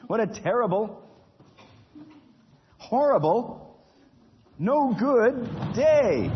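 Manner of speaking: high, drawn-out lament of a miserable man; a hammed-up cry over a terrible day; despairing wail. Nigel's lament after ticket.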